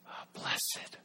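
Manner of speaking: whispered awe. Real, not acted. Hushed opening of beatitude.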